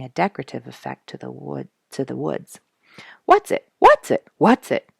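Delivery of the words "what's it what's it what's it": high, loud, bursting with curiosity; tumbling questions, much higher and louder; eager questions. A narrator's reading. Curious Duke bursting with questions for the narrator. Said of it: "Duke was boiling over with questions".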